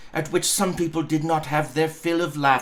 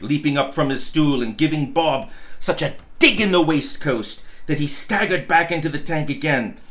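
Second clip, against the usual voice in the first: plain reading voice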